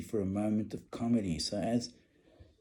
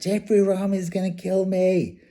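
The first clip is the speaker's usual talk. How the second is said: high, loud panicky plea; boy pleading with his mother, panicked; panicked kid; 9 semitones higher, 8 dB louder than usual. Retold or imitated. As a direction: whine it like a scared teenager. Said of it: "It's like".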